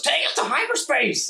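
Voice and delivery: cocky captain punching it; Zaphod command. Acted. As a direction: Zaphod yelling to jump.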